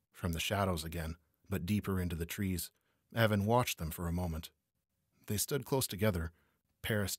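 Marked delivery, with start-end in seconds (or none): none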